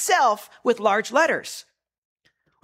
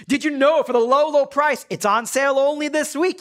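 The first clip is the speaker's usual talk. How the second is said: higher, hyped-up sales pitch; pushy salesman; 7 semitones higher than usual. Retold or imitated. Preacher mocking a salesman's pitch to the congregation, hyped-up and higher.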